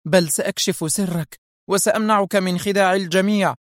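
high, urgent exclamation; defiant cry. A narrator's reading. Sonia rushing to unmask the man, crying out. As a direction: cry it out while lunging at him. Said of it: "واندفعت نحوه هاتفة".

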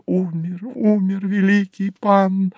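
keening on a high held note; drawn-out plaintive cry. A narrator's reading. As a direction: lament it like distant grieving voices. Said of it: "протяжные жалостные возгласы".

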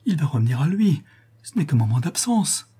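cling to hope in distress; heartrending plea. A narrator's reading. Daughter insists her mad father will recover, heartrending. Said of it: "d'une voix déchirante".

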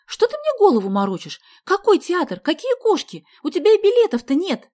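high, loud indignant questions; exploding. A narrator's reading. Sonya accusing Vasya of fooling her, exploding. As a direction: burst out and build in pitch and volume. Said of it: "вдруг взорвалась она".

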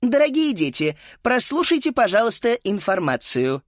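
high, bright PA announcement; cheerful announcer. Acted. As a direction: address the children over the speaker. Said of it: "женский голос продолжил".

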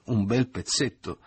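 slow, derisive relish; scornful false hilarity. A narrator's reading. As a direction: stretch it out to mock the proposal. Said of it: "appena questa sua falsa ilarità".

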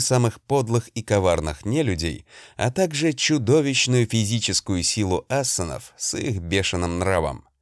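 plain reading voice

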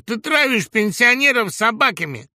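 high, loud denunciation; accusing shout. A narrator's reading. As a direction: yell the charge at him. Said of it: "крикнул Гусев". (обвиняющий крик)

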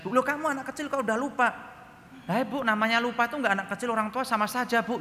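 put-on high, cheeky kid's voice; child talking back. Son answering his teacher back, high and cheeky. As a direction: play the clever little boy arguing.

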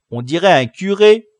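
loud, high remark; amused exclamation. A narrator's reading. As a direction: call it out as a discovery. Boy comments on the blouse.

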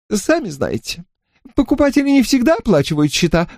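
high, quick, fluttery landlady; fussy shopkeeper voice. Acted. Narrator voices shopkeeper Mrs Brandy.

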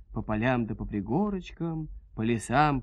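ordinary delivery, nothing marked

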